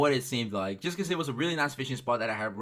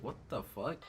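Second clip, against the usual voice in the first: much softer, muttered to himself; inner thought; 3 semitones lower, 11 dB quieter than usual. Retold or imitated. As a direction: swear under your breath. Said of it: "in my head, I was like".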